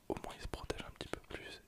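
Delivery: ordinary delivery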